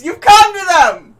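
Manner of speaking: high, loud yell across distance; full-volume high holler; shouted repetition. Acted. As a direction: bellow it so they finally hear. Repeating himself to someone who can't hear.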